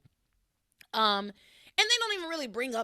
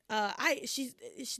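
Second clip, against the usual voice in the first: normal talking voice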